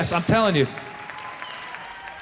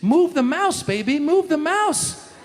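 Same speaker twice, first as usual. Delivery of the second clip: slow pleading; 8 semitones higher, slower than usual. Acted. Urging dumb tweeters.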